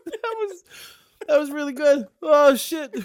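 words squeezed out between high laughs; trying to talk through the giggles; breathless laughing aftermath; still laughing. Real, not acted. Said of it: "I wanted you to stop too".

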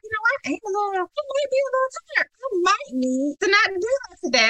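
high, soft voice of reluctant admission; high-pitched, hesitant self-talk; reluctant inner voice. Retold or imitated. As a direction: admit it in a small, high voice. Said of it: "And in my mind".

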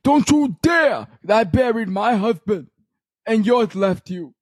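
high, slow screeching reenactment of a reality-show fight; over-the-top mock screaming housewife; Dorinda's famous outburst. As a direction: shriek it like a hammed-up housewife meltdown. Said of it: "where Dorinda had the famous".

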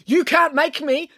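high, drawn-out defiance; slow, desperate high protest. A narrator's reading. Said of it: "said April desperately".

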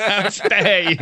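high, loud indignation breaking into laughter; mock outrage. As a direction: play affronted, then crack up.

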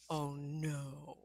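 low, hushed mock dread; soft, low thought voiced aloud; dawning dread. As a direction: sink into a quiet realization. Retold dawning realization in her head.

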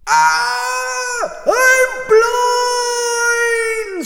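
high, very loud, drawn-out wail; screamed. Acted. Blinded Cyclops pulling out the stake, screaming. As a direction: howl in agony, stretching the words. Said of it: "screamed Polyphemus".

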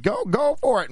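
very high, urgent egging-on; retold high-pitched taunt; goading. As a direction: dare him in a high, heated voice. Goading the man in retold quarrel.

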